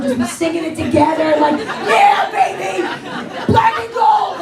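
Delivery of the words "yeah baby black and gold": crowd cheer. Retold or imitated.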